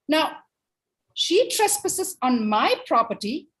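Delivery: high, emphatic mock protest; indignant wolf. Wolf protests the girl trespassing on his property, indignant. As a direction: argue your case, leaning hard on each grievance.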